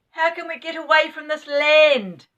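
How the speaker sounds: put-on boy yelling over a loud song; shouting. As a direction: shout to be heard over singing. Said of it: "Joe shouted".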